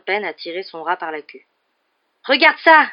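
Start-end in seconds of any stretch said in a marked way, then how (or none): 2.2-2.9 loud, high angry outburst; furious raised voice. A narrator's reading. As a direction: thrust it at them in fury. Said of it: "dit-il avec fureur".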